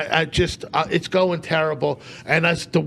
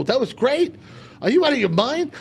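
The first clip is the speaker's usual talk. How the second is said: high-pitched echo in disbelief; incredulous retort; 8 semitones higher than usual. Retold or imitated. Comic answering clueless host after bombing, incredulous retort. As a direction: throw the praise back as an outraged question.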